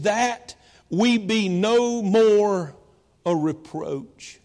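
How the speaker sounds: drawn out, each word weighted; very slow, weighty. Acted. Pastor repeating the verse to the congregation, very slow and grave. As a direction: stretch the words right out.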